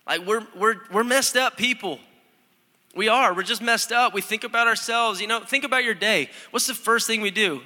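rising sermon fervor, pleading with the church; fired-up preaching. Acted. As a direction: drive it home to the congregation. Preaching at full force.

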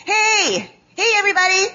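high, hammed-up Midwest hey calling the kids; mock Midwest camp call. Counselor calling campers to line up, hammed-up high Midwest call. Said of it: "I'd always say".

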